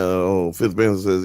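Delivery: ordinary talk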